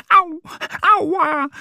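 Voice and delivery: hoher, lauter Schmerzensruf; schmerzvolles Au. Acted. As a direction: schrei kurz auf vor Schmerz im Flügel. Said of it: "ruft er". (hoher Aua-Ruf des Kükens)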